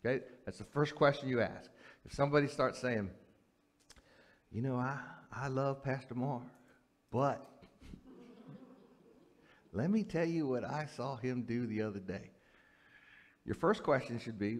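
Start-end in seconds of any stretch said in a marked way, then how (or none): 4.5-12.3 soft, confidential mock gossip; confiding gossip. Gossip starts on Pastor Mark, hushed and confiding. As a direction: lean in quietly as if sharing gossip.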